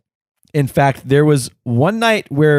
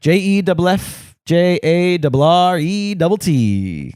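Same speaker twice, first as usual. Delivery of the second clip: spelled out in a higher, put-on voice; sing-song wrestler name spelling; imitating Jeff Jarrett spelling; 6 semitones higher than usual. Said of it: "That's the way he would say it".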